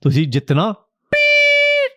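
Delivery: imitated pep talk, high and slow; rally the troupe like a mock team captain; hammed-up captain's pep talk, drawn out; spirited captain. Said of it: "very spirited captain, was giving a pep talk".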